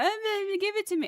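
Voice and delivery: high, whiny begging voice; excited crying cat. Retold or imitated. Cat begging as the can opens, high excited whine. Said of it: "is so excited. He's, like, crying".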